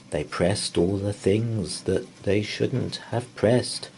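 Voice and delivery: ordinary delivery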